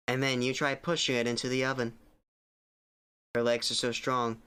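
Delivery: ordinary delivery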